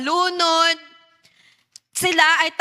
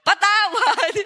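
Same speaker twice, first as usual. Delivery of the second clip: mock plea for forgiveness; 5 semitones higher, slower than usual. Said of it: "Anong karugtong?".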